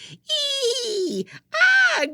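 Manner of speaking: very high, very loud shrieks; piercing screams. A narrator's reading. Prisoner shrieks at the girl from her cell, piercing screams. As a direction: scream like she's losing her mind. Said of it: "her laugh turned to screams".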